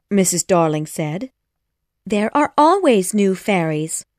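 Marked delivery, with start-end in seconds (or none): none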